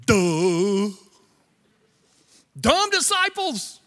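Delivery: mock the disciples' foolishness.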